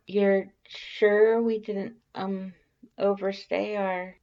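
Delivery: high, slow, timid voice fading out; trailing off. Acted. Alphys afraid they overstayed, slow high trailing worry. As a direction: let the worry drag and die away. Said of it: "Alphys trails off".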